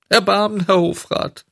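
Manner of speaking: very high, wailing plea; tearful pleading. A narrator's reading. Puppet trying a grander title on the director, pleading. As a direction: beg in a high wail. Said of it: "weinte und flehte".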